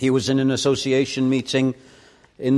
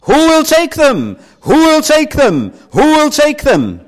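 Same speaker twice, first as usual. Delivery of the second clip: very loud, high auctioneer shout; shouted at the top of his voice; 10 dB louder than usual. Retold or imitated. Elias calling to the whole meeting, shouted at full voice. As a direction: call it out at the top of your voice. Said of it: "he shouted at the top of his voice".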